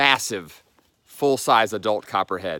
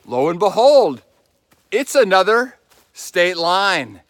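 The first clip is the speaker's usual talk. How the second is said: slow, raised, theatrical discovery; mock surprise; 6 semitones higher, 6 dB louder, slower than usual. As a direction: act amazed at finding it. Mock surprise at new state line.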